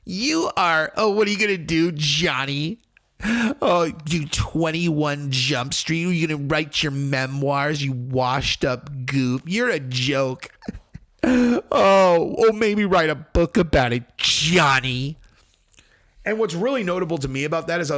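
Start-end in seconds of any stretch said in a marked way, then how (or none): 0.0-10.5 put-on sneering drunk put-down, drawn out; mock drunk taunting. Drunk wife belittling Johnny's memoirs, mock taunting. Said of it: "she's drunk and she's all". 11.3-15.2 loud, high mocking jeer; mock drunk taunting. Drunk wife jeering at Johnny about a book, mock taunting. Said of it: "she's drunk and she's all".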